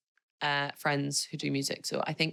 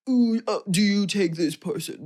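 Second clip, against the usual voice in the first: louder, pompous mock officiant; 4 semitones higher, 4 dB louder than usual. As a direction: ham up a stiff priest. Mocking stuffy wedding officiant.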